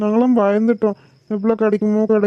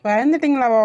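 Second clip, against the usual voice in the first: higher, disbelieving echo question; incredulous echo; 4 semitones higher than usual. Acted. Father echoing daughter's question about mother's fear, incredulous. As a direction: throw the question back in disbelief.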